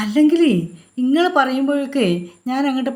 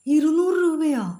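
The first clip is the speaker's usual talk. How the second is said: shocked at the price; 6 semitones higher than usual. Acted. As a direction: pitch jumps up in disbelief. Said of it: "കണ്ണു തള്ളി". (വില കേട്ട് ഞെട്ടിയ ചോദ്യം)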